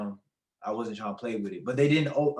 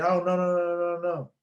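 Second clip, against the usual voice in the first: higher, louder string of no's; panicked pleading; 6 semitones higher, 3 dB louder than usual. Retold or imitated. Son begging his mom not to take him home, panicked. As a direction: beg her to stop, flustered.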